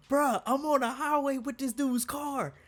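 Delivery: high, loud imitation of a friend yelling; panicked phone yell. Friend phoning coworker from a customer's car, imitated yell. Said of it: "this man just yells in my ear".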